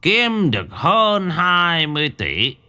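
slow, solemn announcement from the crime boss; booming boss. A narrator's reading. Old boss Khải announcing to the workers, slow and grand. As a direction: proclaim it slowly to the whole room. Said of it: "Lão dõng dạc".

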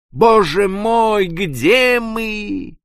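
высоко, медленно, со стоном; простонать в отчаянии. Acted. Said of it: "простонал". (старпом стонет, не понимая, где корабль)

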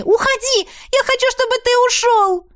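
put-on girl shrieking at the dragon to leave; screaming. A narrator's reading. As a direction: scream it with hands over your ears. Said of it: "кричу я".

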